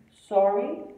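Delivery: "Sorry" with a rising, questioning end; questioning intonation. Shown as a demonstration. To someone you didn't hear, a questioning "Sorry" that rises. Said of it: "Só que aí vai sair assim".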